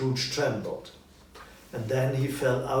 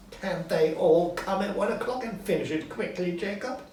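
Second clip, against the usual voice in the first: higher, a touch louder thanks; grateful Scrooge; 8 semitones higher, 5 dB louder than usual. Acted. Scrooge thanking Marley, higher and eager. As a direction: thank your old friend.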